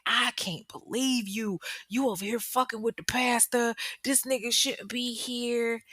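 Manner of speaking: high-pitched, aggrieved imitation; mock mad. Jealous ex accuses her over the pastor, mock mad. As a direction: act out his indignation, without shouting. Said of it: "Brian's mad".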